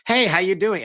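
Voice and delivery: high, bright-sounding mock robot greeting; fake cheery greeting. Guest mocking the fake friendly hotel phone bot.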